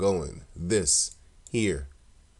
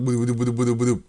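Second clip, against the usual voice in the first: quick, louder boop boop boop noises, a little higher; rhythmic mock boops; 4 semitones higher, 5 dB louder than usual. Joking to viewers about a flimsy house, playful boop noises. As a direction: bounce out the boops like a squeaky rhythm. Mimics bed noise, crude joke.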